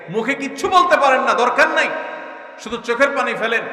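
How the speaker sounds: high and loud urging; fervent exhortation. Real, not acted. Preacher urging listeners to weep, high and loud. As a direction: urge them with fire.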